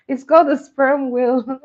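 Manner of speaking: amused exclaiming; laughing loud correction. Real, not acted. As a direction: set them straight through laughter.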